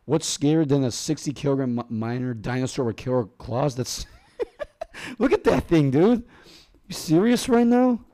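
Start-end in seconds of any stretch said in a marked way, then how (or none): 4.4-5.1 high squeaky laugh. Real, not acted. Laughs at the cassowary photo. 5.2-8.0 rising, louder and higher, stunned; can't believe what you see; shocked disbelief. Real, not acted. Shocked at the cassowary photo.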